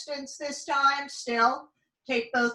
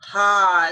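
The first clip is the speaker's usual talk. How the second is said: big audible sigh out; loud breathy exhale; 4 semitones lower, 8 dB louder than usual. Shown as a demonstration. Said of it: "with some long exhales".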